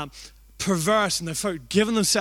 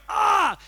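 much higher yelp of pain; pained cry; 13 semitones higher, 4 dB louder than usual. Retold or imitated. Hit in the spine by friend, high pained yelp. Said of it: "And I go".

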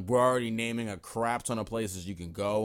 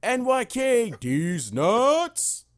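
high, loud microphone holler; imitated announcer shout; 14 semitones higher, 9 dB louder, much slower than usual. Imitating a tournament host calling a name on the mic, loud high holler. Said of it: "he was like".

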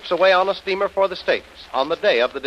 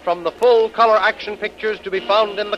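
radio announcer; 4 semitones higher than usual. Acted. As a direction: boom it out like an old radio host.

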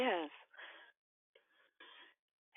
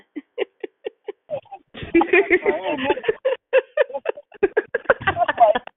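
long high delighted laugh; bright laugh; 6 dB louder than usual. Real, not acted. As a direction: laugh with glee at a friend.

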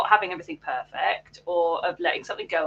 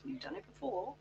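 small, hushed, higher-pitched inner voice; the soft little voice in your head; quiet, encouraging self-talk, pitched up; 8 semitones higher, 13 dB quieter, faster than usual. Acted. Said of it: "that little voice in the back of your head".

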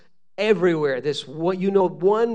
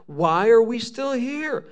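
high, louder, slow exasperated question; troubled self-questioning; 6 semitones higher, 5 dB louder than usual. Acted. Daniel asks himself about God's delay, bewildered. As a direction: stretch it out in raised-pitch bewilderment. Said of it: "asking these questions to himself".

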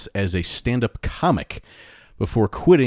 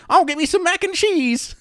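bad Texas accent. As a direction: play a kid doing a cheesy Texas accent. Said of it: "worst Texas accent I've ever heard".

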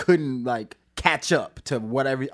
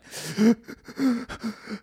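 sharp gasp at icy water; imitated shock gasp; cold shower gasp; 10 semitones higher than usual. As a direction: gasp like cold water just hit you. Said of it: "cold showers where I'm just like".

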